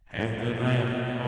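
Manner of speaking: slow, loud demonic voice changer. Real, not acted. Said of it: "Demonic voice".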